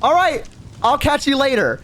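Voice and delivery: high, fake-casual sign-off; acting out a too-cheery farewell; breezy goodbye. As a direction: pitch it up as you make your escape. Hurried goodbye after fake number.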